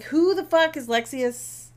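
high, loud incredulous question; mock bafflement. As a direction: demand it as a baffled rescuer. Retold baffled rescuers' question.

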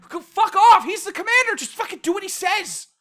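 loud, high-pitched frustrated yelling; hammed-up irate shouting; exasperated outburst. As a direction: tell off a bickering character, fed up. Acted exasperated outburst at game dialogue.

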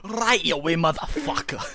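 loud, high, hammed-up Scottish accent; bad Scottish accent. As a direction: yell it in a terrible Scottish accent. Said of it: "Worst Scottish accent ever".

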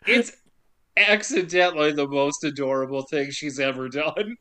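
ordinary talk

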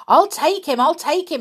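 high, loud urgent call; shouting. A narrator's reading. Peter shouting to the old caretaker, urgent. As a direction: shout it to the deaf man. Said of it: "he shouted to the old man".

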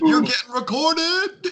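mock alarm. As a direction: squeal the warning. Mock alarm at being recorded.

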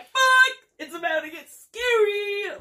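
high, loud yell of fright; mock panicked yell. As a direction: yell it like the horror just kicked in. Retelling her own fright.

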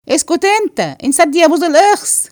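sharp, high-pitched telling-off; angry rebuke. Acted. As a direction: shut them up crossly. Acted quarrel, shutting someone up. (زجر بغضب وصوت عالي الطبقة)

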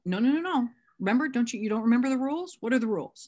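acted-out insistent reprimand; firm correcting. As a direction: cut in like a sponsor reminding someone of rules. Sponsor corrects sponsee in retold call.

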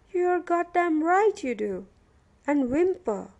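high, loud, emphatic accusation; exasperated prisoner rubbing it in; angry confirmation. A narrator's reading. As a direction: hammer the grievance home. Prisoner confirms complaint angrily.

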